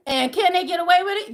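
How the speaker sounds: ordinary delivery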